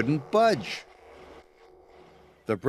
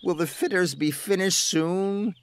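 pleading; 5 semitones higher than usual. A narrator's reading. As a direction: beg the boss gently. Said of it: "pleaded Edward".

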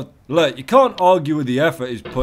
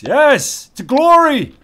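much higher and louder victory yell; triumphant whoop; 13 semitones higher, 9 dB louder, much slower than usual. Real, not acted. Gamer yelling in triumph as he climbs, high and loud. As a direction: cheer it like a conquering hero.

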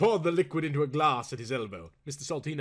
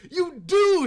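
high, loud genial reply; homely earl; 11 dB louder than usual. A narrator's reading. Earl confirming his title with a homely smile. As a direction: beam it out with homely pride. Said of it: "said the Earl, with a homely smile".

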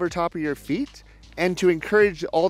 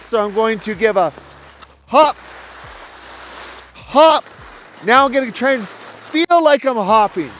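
raised, louder voice over the wind while skiing; live on-slope coaching; 5 semitones higher, 5 dB louder than usual. Real, not acted. Ski coach calling instructions to students mid-run, raised and loud. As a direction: call it out loud as you ski the drill.

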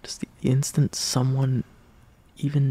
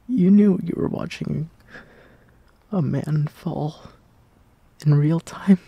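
louder, breaking out of the whisper; suddenly louder; rising outburst; 3 semitones higher, 7 dB louder than usual. Acted. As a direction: let the hurt push the words out louder. Emotional outburst in confession.

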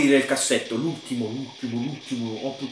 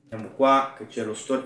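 ordinary talk, nothing marked

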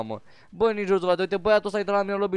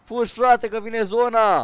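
louder, raised-pitch call to flee; urgent warning; 4 semitones higher, 4 dB louder, slower than usual. Real, not acted. Player urges teammate to run from the zone, urgent warning. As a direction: shout it to hurry your teammate along.

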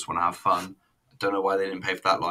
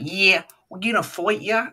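high, loud, put-on Brooklyn squawk; hammed-up Brooklyn fighting talk; bad Brooklyn accent; 13 semitones higher, 7 dB louder than usual. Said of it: "shows off she's from Brooklyn".

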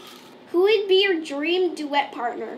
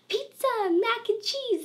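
high mocking singsong; high mock voice of everyone else; 9 semitones higher than usual. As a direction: mimic the boring crowd pick, pitched up high. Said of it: "Everyone's like".

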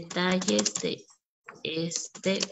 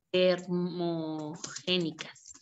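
slow dictation; 2 semitones lower, 5 dB quieter, much slower than usual. Shown as a demonstration. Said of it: "en la O lleva el acento".

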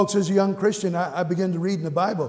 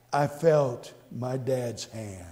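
tender memory; 6 semitones lower, 5 dB quieter, much slower than usual. Real, not acted.

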